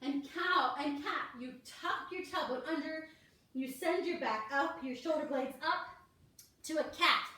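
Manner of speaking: ordinary talk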